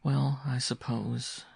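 slow, drawn-out reluctant answer; slow and bitter. A narrator's reading. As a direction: draw it out with a touch of bitterness. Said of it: "she said slowly and bitterly".

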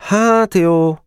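high, reluctant agreement; resigned concession; reluctant resignation. A narrator's reading. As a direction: give in unwillingly. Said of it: "Szomorúan nyugodott bele".

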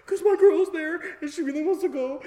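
high, whiny near-sob; mock breakdown. Storyteller explaining himself to his friend, high mock-tearful whine. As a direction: wail the excuse, tearfully overdone. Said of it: "let's not have a mental breakdown".

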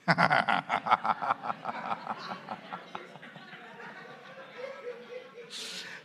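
long high-pitched laugh. Real, not acted. Laughs after shouting repent. (risa aguda)

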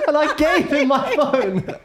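words squeezed out through laughter, slowed; can't stop laughing at your own story; high giggling retelling. Real, not acted. Laughing while retelling own naivety.

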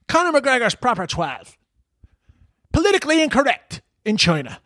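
very high mocking Dublin accent; Conor McGregor. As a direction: take the piss with a squeaky Dublin accent. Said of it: "taking the piss out of Conor McGregor in a Dublin accent".

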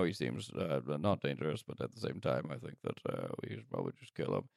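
dropping low and quiet, almost a whisper; low sinister whisper. Acted. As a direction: plot murder in a low hush. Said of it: "they're just like".

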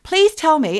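high, loud re-enacted plea; beg, in acted alarm; scared pleading. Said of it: "was really scaring me".

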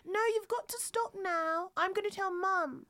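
whiny small sister telling off her brother; Lola from Charlie and Lola. Pretend. Friend doing a cartoon voice, a whiny little girl. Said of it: "Charlie and Lola".

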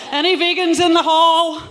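stage comic's crowd shout; calling out. Real, not acted. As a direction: throw the question out to the whole hall. Calls out to the live audience.

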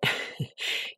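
soft unvoiced chuckle under the breath; breathy silent laugh. Real, not acted. Host searching for a nice way to say it, hushed laugh. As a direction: laugh quietly to yourself. Laughs before saying it nicely.

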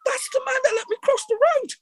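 put-on high, squeaky English kid's voice, half shouting; a young English boy calling out in a very high voice; English schoolboy voice. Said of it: "said in my English voice".

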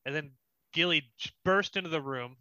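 usual speaking voice

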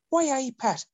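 squeaky, very high Irish-tinged Geordie; hammed-up bad Geordie with Irish lilt; failed Geordie turned Irish. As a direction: get Geordie hopelessly wrong, sounding Irish. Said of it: "No, you're doing Irish".

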